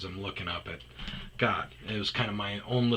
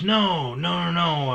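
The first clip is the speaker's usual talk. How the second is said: high, loud retold protest; raised-pitch, emphatic refusal in her voice; imitated insistent woman; 8 semitones higher, 7 dB louder, slower than usual. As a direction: mimic her brushing off a wrong guess. Woman rejects his guess, retold.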